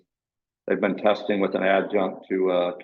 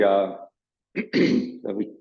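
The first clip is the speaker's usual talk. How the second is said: throat clearing; much slower than usual. Real, not acted. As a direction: voice catching in the throat. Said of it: "excuse me for that".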